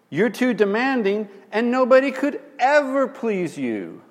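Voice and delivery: accusing husband. Retold or imitated. Husband accusing wife in argument.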